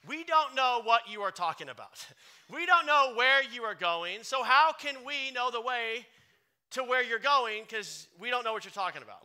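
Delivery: loud, bewildered disciple; doubting Thomas, loud and confused; Thomas voiced. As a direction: play a baffled Thomas crying out. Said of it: "Thomas speaks up. He says this".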